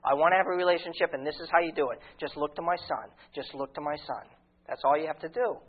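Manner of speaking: voicing God gently. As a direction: speak as God, gentle and tender. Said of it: "And God said".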